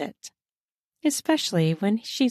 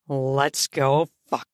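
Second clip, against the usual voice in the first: male voice; 6 semitones lower, slower than usual. A narrator's reading.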